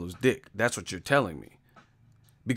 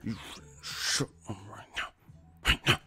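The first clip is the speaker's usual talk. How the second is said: low-volume threat through pursed lips; puckered-mouth angry mom; 3 semitones lower, 9 dB quieter than usual. Retold or imitated. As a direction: imitate mom scolding under her breath. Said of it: "Doing that asshole mouth and shit".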